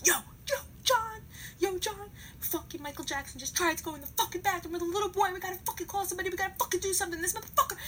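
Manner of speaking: high-pitched frantic pleading; freaking out. Retold or imitated. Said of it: "And I'm freaking out".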